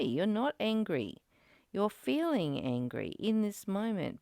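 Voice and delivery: usual speaking voice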